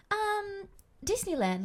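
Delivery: high, bright in-character princess answer; sweet princess voice. Retold or imitated. Performer refusing to break character, high princess voice. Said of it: "She was like".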